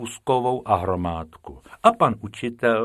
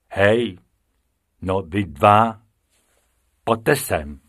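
deep voice calling; 5 dB louder, slower than usual. A narrator's reading. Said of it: "ozval se hluboký hlas".

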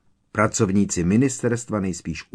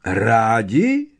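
loud, rising incredulous question; astonished; 3 semitones higher, 6 dB louder than usual. A narrator's reading. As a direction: echo the word in amazement. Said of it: "Pitlouna jeho otázka ohromila". (ohromeně)

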